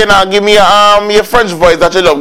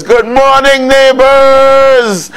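drawn-out host greeting; 7 semitones higher, much slower than usual. Shown as a demonstration. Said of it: "host's sign-off catchphrase call".

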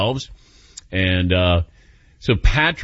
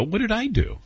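mock surprised protest; 10 semitones higher, 3 dB quieter than usual. Retold hurt protest.

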